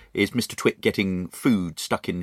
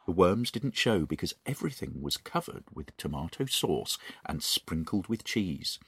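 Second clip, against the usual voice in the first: steady, soft read-aloud narrator voice; story narration; 4 semitones lower, 6 dB quieter than usual. A narrator's reading. Host reading The Twits to a friend, soft narration. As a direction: read it like a bedtime story to children.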